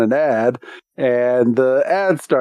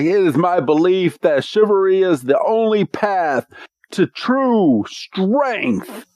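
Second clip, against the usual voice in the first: higher, slowing right down at the end; solemn knight; 6 semitones higher than usual. Retold or imitated. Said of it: "And he's like".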